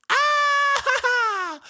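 very high loud shriek. Retold or imitated. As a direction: shriek at cold water. Hot water runs out.

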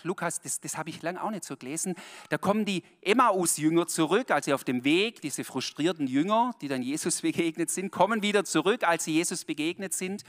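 ordinary delivery

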